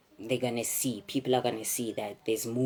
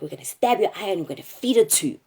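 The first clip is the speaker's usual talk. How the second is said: fast, higher, louder threat; retold threat shouted; 8 semitones higher, 8 dB louder, faster than usual. Kidnappers threatening to feed her own eye, fast loud shout.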